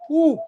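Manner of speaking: a sharp high whew of excitement; excited whew. Real, not acted. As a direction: let out a high-pitched whew. Exclaiming about a powerful team.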